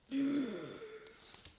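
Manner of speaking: breathy rising gasp; whispered gasp. Acted. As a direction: gasp in shock. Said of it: "I gasped".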